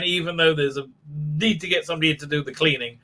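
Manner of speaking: usual speaking voice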